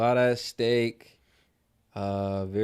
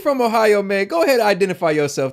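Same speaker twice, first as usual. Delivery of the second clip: loud, high call to the chat; rally the Ohio viewers loudly; 5 semitones higher, 7 dB louder than usual. Real, not acted. Host calling Ohio viewers in the chat, loud rally.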